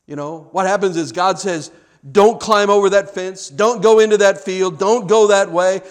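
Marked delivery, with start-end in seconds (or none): 2.1-5.8 loud, raised preacher's warning; forceful command from God; urgent warning. Acted. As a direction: firmly warn them off. God's stern warning, voiced.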